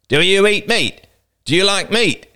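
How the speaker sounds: put-on high eager boy voice; boy's voice.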